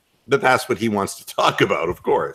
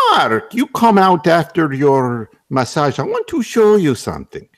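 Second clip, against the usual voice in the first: friendly Russian masseur; friendly Russian accent; 6 semitones higher, 4 dB louder than usual. Retold or imitated. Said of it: "Russian massage therapist".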